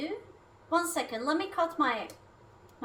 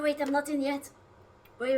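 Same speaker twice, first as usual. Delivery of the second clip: ordinary talk, nothing marked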